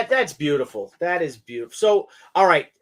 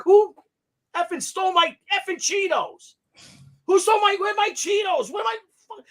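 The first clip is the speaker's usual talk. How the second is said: a hammed-up furious New Yorker demanding his Cheetos; New York rage; 13 semitones higher than usual. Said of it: "now I'm from New York".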